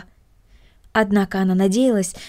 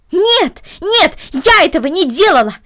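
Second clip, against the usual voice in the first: shrieking no, much higher and louder; a child yelling her innocence at full force; screamed denial rising to a peak; louder protest; 9 semitones higher, 7 dB louder, slower than usual. Acted. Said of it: "ещё громче протестовала Корнели".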